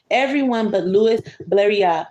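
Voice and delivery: ordinary delivery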